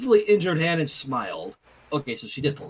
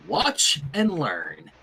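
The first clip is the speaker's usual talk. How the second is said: slow, deliberate watch and learn; confident show-off; 4 semitones lower, much slower than usual. Acted. Rainbow showing Sunset her trick, slow and smug. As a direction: draw it out like a magician.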